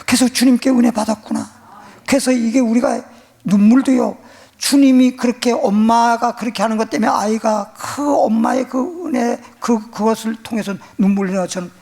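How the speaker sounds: preaching. Acted. Preacher telling congregation about grace, fervent. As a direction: build to the sermon's climax.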